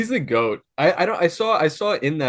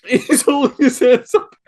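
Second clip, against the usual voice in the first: laughing; 9 semitones higher, 4 dB louder than usual. Real, not acted. Laughing fit while describing.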